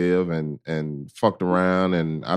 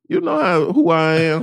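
much higher, swaggering boast; cocky; 8 semitones higher than usual. Acted. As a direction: show off who you are. Bragging about himself.

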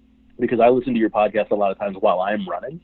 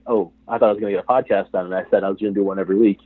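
fast, flustered rush of words; hurried; 2 semitones lower, faster than usual. Retold or imitated. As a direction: rattle it off in a panic.

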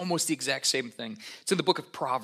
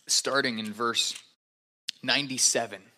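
ordinary talk

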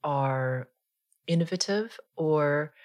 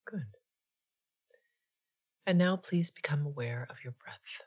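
guided meditation; 3 dB quieter than usual. Acted. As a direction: ease them gently into stillness, unhurried.

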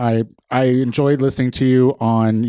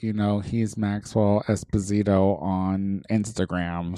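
normal talking voice

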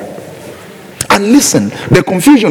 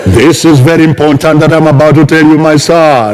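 booming God voice. Shown as a demonstration. Preacher parodying a dramatic God speaking to Abraham, booming. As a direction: announce it like a thundering deity. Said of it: "God is not going to speak like this".

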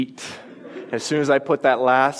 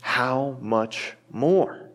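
very slow, lingering question on "more"; slow emphasis; much slower than usual. Acted. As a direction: hold "How much more?" slowly, rising on "more". Repeated key phrase.

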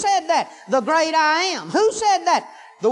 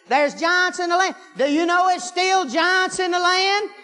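rising sermon cadence, pitch lifted; preaching at full force; 6 semitones higher than usual. Real, not acted. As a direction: preach it, building the fire. Preacher rises again in fervour.

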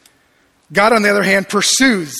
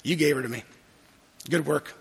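muttered blame; 5 semitones lower, 9 dB quieter, faster than usual. Retold or imitated. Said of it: "looks at God and goes".

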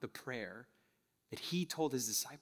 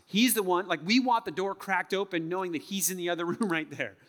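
higher, louder, driving sermon delivery; impassioned preaching; 5 semitones higher, 6 dB louder than usual. Real, not acted. Preacher to congregation on God's closeness, impassioned preaching. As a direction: preach it with rising force.

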